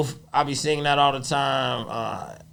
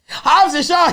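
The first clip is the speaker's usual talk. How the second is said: usual speaking voice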